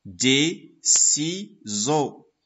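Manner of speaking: "Des ciseaux" very slowly split as des, ci, seaux; syllable by syllable. Shown as a demonstration. Teacher ordering syllables, "Des ciseaux" very slowly broken apart. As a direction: pause after each syllable of "Des ciseaux". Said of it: "Première syllabe".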